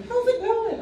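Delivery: mock-bright greeting; chipper coworker. Coworker greeting her cheerfully at work, lightly mocked. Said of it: "You're happy at work".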